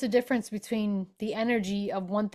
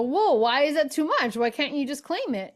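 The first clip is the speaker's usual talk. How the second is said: surprised challenge; 6 semitones higher than usual. Retold or imitated. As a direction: push back in mock disbelief. Speaker challenges friend's refusal.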